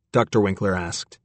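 ordinary delivery, nothing marked

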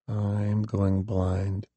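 barely enough breath left; the dying warlock tells her he is losing sight; weak and fading. A narrator's reading.

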